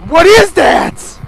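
slow, high, loud scared cry; drawn-out frightened yell; frightened shout. Real, not acted. Glowing eyes scare.

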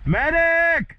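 yelling for a medic; man down shout. Real, not acted. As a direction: call out loud for help. Calling for medic again.

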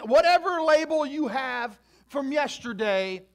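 loud, rising sermon climax; preaching at full force. Acted. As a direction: preach it big and loud to the crowd.

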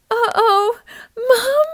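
a tiny acorn calling out as he slips; scared cry for mum. A narrator's reading. Acorn falling from the branch, crying out high. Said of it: "his stem began to pull away".

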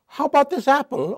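hint of a sly serpent; snake tempting. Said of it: "a talking snake who says".

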